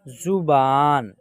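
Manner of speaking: जुबान slowly, short जु, long बा; drawn-out model reading. Shown as a demonstration. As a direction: hold the आ in जुबान. Teacher models the blended word.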